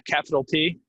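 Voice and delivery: ordinary delivery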